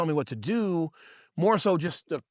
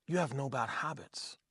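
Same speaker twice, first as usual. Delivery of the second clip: kneel and say it softly, man to man; quiet encouragement; 6 dB quieter than usual. Retold or imitated. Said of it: "he fucking kneels down and he's like".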